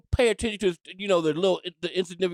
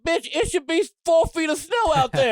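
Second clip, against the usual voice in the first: outraged shout; 6 semitones higher, 3 dB louder than usual. Retold or imitated. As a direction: yell at the screen in disbelief. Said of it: "I'm like".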